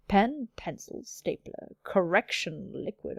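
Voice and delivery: hushed, teasingly slow delivery; mock suggestive. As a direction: linger on it as if it were innuendo. Mock-suggestive reading of stationery list.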